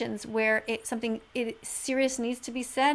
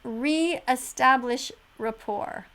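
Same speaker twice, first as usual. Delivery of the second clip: drawn-out repeat; much slower than usual. Real, not acted. Repeating the advice slowly.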